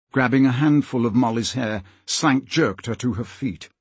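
ordinary delivery, nothing marked

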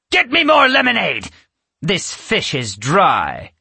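very high, loud demanding order; imperious witch. A narrator's reading.

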